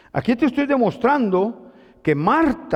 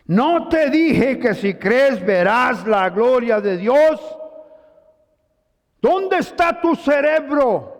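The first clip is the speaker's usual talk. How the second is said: slow, louder rebuke, only half a shout; mock shout of Jesus; 4 semitones higher, 3 dB louder, slower than usual. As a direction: drag out the scolding question like a stern teacher. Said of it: "Jesús le gritó". (reprimenda lenta y algo más fuerte, grito fingido)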